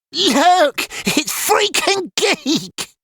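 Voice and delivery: high, slow mocking sing-song; jeering call. A narrator's reading. Boys jeering back at Ilian, high mocking call. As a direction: taunt while running off. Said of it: "they called, laughing".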